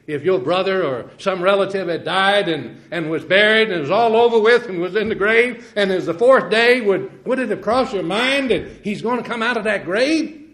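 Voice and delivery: high, loud preaching cadence; rising revival sermon voice; fervent preaching. Acted. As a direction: lift it into full fervent preaching. Preaching at full force.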